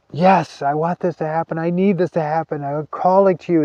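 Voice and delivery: pleading call pitched high, from the gut; passionate call. Shown as a demonstration. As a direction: call it out with full passion, pulling from your guts. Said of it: "call with as much passion as you can".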